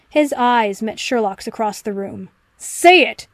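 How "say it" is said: sharp bark, louder and higher; barked order. A narrator's reading. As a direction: snap it out like a command. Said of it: "he barked".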